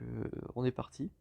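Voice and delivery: ordinary delivery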